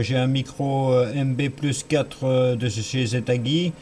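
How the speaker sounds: ordinary talk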